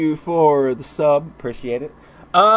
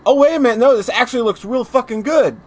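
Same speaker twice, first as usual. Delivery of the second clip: excited realization; 7 semitones higher, 4 dB louder, faster than usual. Retold or imitated. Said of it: "I was like".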